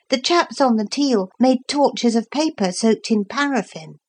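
put-on a boy thinking it through aloud; Philip. A narrator's reading. Philip explaining the book to Lucy, lightly voiced boy.

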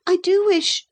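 put-on very high-pitched boy's wish; pleading boy. A narrator's reading. Boy appealing to the captain, pleading.

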